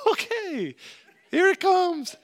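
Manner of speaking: high-pitched mock apprehension; dreading employee. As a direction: squeak it out, bracing for a scolding. Imitating Chris dreading a scolding.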